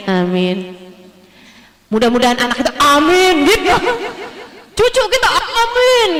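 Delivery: resigned amen. Retold or imitated. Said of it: "doa orang putus asa".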